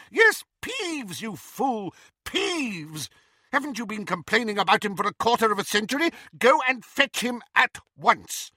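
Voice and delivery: high-pitched impatient tirade; exasperated scolding. A narrator's reading. Professor orders the caretaker to fetch Peeves, exasperated. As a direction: scold him in a high, forceful voice.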